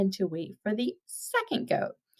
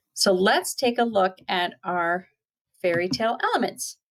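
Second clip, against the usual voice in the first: plain reading voice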